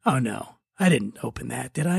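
hungover dread, low and soft; mock dread. Host retelling waking to find the wine opened, low mock dread. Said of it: "the counter and I'm like".

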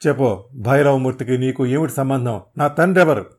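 loud, harsh demand rising to a yell; furious interrogation. Acted. Hero beating the thug, furious loud demand. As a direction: demand the truth with fury.

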